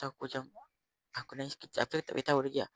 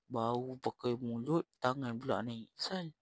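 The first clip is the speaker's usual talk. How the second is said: ordinary delivery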